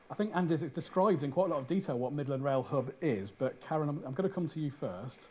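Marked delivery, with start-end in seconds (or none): none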